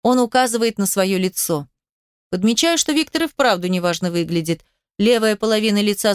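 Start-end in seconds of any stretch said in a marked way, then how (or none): none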